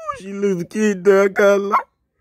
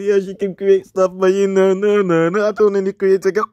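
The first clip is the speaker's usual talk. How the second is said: ordinary talk, nothing marked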